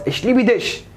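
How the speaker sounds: high-pitched, as if hurling a brick; mock harsh German. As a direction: make the love phrase sound like an attack. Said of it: "مسكت طوبة وخبطته بيها". (بخشونة مصطنعة وصوت عالي الطبقة)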